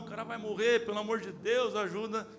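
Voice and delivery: high, frantic begging; terrified onlookers begging the retired firefighter to help; panicked pleading. Retold or imitated. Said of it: "os caras apavorados".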